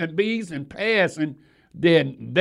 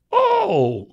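high exclamation; 3 dB louder than usual. Real, not acted. Sudden exclamation.

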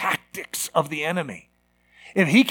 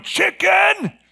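high, mocking shout; taunting jeer; 12 semitones higher, 4 dB louder than usual. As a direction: jeer at the one who flinched. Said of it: "laughing, going".

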